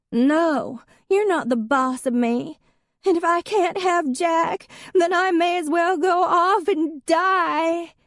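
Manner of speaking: put-on very high, loud drunken wail; melodramatic drunk despair. A narrator's reading. Drunk ex-wife threatening to die, very high and loud. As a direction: wail it like a tragic heroine.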